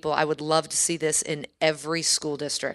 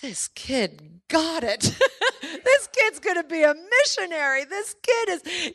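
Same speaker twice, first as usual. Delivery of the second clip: much higher, slow, tearful and moved; choked up in tears; 10 semitones higher, slower than usual. Real, not acted. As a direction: close to tears, with a laugh breaking through. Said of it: "I'm, like, in tears going".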